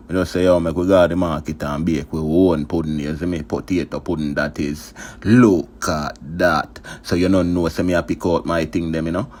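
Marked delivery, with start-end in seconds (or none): 5.2-6.8 drawn-out, louder proud catchphrase; slow catchphrase. Acted. Cook showing viewers the dish, slow proud catchphrase. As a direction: stretch every word slowly, showing off the dish.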